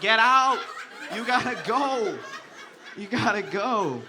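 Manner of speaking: loud, high, slow get out, breaking into laughs; urgent shout. Retold or imitated. Comic shouting the girl out of his place, laughing. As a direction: order her out while cracking up.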